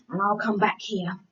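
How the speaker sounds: ordinary delivery, nothing marked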